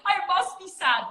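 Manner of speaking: high, put-on puzzled voice; mocking a beginner who thinks tears mean sadness, high put-on voice; mock confused crier. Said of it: "don't ever think".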